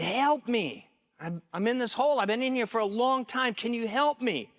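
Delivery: high, urgent yell up from below; calling for help. Acted. Trapped man to passing doctor, calling up for help. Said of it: "yells up at him".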